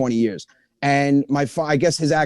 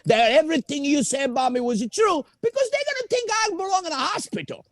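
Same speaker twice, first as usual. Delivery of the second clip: dad voice; 8 semitones higher than usual. Retold or imitated. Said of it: "He goes".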